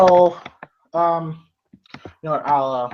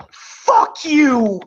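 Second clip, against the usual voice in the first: defiant yell; 7 semitones higher, 3 dB louder than usual. Retold or imitated. Nancy's badass moment retold.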